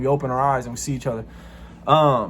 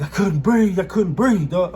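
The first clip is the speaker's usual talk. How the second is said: higher, louder mock panic; imitated panic; 5 semitones higher, 3 dB louder than usual. Cellmate telling the narrator he nearly suffocated, mock panic. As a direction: gasp it out like you nearly suffocated. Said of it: "he's panicking".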